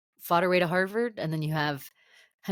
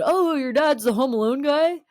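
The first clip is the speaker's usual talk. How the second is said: high, loud mock amazement; retold starstruck blurt; 9 semitones higher, 6 dB louder than usual. Re-enacting the regretted remark to Henry, starstruck amazement. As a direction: mimic your own gushing disbelief. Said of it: "I wish I kind of had not said".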